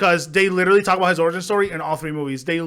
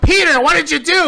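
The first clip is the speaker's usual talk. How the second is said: put-on high, loud scolding shout at the kid; angry parent yell; 10 semitones higher, 6 dB louder than usual. Uncle screaming at Peter over the break-in, loud angry yell.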